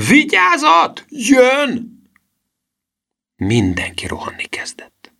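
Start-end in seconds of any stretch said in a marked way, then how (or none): 0.1-2.0 high, loud warning yell; full-throated shout. A narrator's reading. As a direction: bellow a warning at the top of your voice. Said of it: "torka szakadtából ordította".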